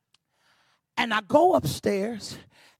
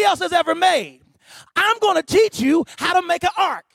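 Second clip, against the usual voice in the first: high, measured proclamation with pauses; impassioned preaching; 9 semitones higher, 7 dB louder, slower than usual. Real, not acted. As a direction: declare God's promise phrase by phrase. Preaches, voicing God to Noah.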